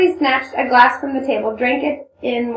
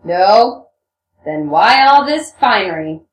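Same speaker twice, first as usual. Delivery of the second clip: knowing jibe in a lowered man's voice; Rakitin, sly taunt; 2 semitones lower, 7 dB louder, slower than usual. Acted. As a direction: drop the voice and needle her. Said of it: "Rakitin taunted her slyly".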